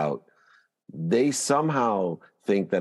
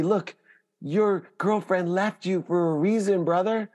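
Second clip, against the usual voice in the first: high, lofty voice of a loving God; God speaking; 12 semitones higher than usual. Acted. God tells a heartbroken believer why, high and lofty. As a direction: lay down the hard truth from above, higher.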